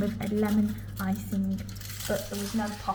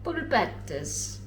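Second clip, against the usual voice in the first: put-on Italian accent; slower than usual. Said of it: "Say it in Italian accent".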